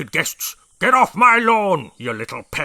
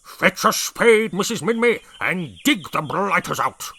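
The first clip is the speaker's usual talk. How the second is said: barking orders; 6 semitones higher, 7 dB louder than usual. A narrator's reading. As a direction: bark the order. Brig ordering Minmy.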